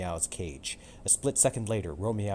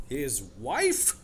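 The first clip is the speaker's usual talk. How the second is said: gasping; 6 semitones higher than usual. Acted. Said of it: "the crowd gasped".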